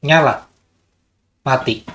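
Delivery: testing on and off commands on the module; alternating commands. Shown as a demonstration. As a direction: snap the commands in turn with equal tune. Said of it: "lebih intonasinya lebih dikenal kalau nyala mati".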